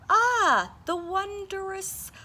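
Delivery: very high, awed, slowed wondrous; marveling bird. Acted. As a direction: marvel at each word. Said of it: "she marveled".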